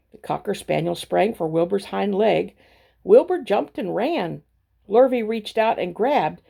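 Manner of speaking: ordinary delivery, nothing marked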